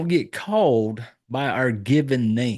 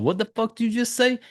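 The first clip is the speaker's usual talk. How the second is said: higher-pitched, imitating an offended wife; mock indignant challenge; 9 semitones higher, faster than usual. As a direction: act out a wife's indignant double take. Wife challenges being called by name.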